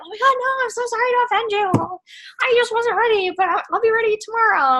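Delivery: high, flustered apology; apologetic customer. Retold or imitated. Customer apologizing to the seller for not ordering, high flustered voice. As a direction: gush out a sorry excuse.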